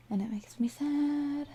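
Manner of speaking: soft breathy mock-sad whisper. Sad aside about merged files.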